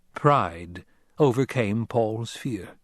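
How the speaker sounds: ordinary delivery, nothing marked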